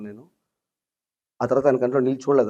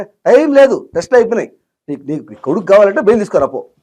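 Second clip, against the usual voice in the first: retold officer barking, much higher and louder; harsh shout; 7 semitones higher, 8 dB louder than usual. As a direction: cut him off with a harsh yell. Officer yelling at father. (గట్టిగా అరవడం)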